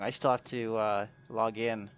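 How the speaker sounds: normal talking voice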